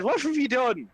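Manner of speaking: high-pitched incredulous protest; Flynn, exasperated. Acted. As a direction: demand answers in exasperation. Flynn accuses Ismark.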